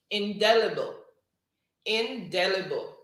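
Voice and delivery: "indelible" said clearly and loud with a falling end; dictated spelling word. Shown as a demonstration. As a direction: call "indelible" out clearly like a spelling bee caller. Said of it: "word number four is".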